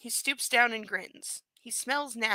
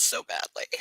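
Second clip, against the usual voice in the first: whisper. Real, not acted. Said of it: "unvoiced whisper".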